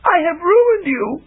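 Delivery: high, slow, tearful lament; crying. A narrator's reading. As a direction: wail with guilt. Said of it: "Chameleon cried".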